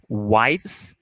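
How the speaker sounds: slow "wives" with a voiced V buzzing before the final s; clear V ending. Shown as a demonstration. Teacher drilling students on "wives", the final V clearly voiced. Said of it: "com o som de V mesmo também no final".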